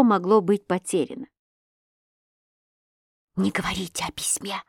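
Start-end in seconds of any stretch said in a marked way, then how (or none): 3.4-4.6 urgent hushed whisper; quick urgent whisper. A narrator's reading. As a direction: whisper it fast so no one hears. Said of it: "сказала я быстро шёпотом".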